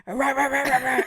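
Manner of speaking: loud mock barking rah rah; excited dog barks; dog barking impression. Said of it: "he's like".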